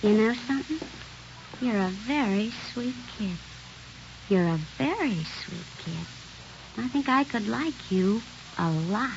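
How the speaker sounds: hushed, tender flirting; soft seductive coo. Acted. Belle cooing to shy Richard. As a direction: soften to draw him in.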